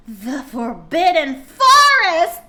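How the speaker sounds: slow, loud dramatic build; dramatic reading. Acted. Streamer reading fanfic, slow loud ominous stress. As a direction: say it like an ominous reveal.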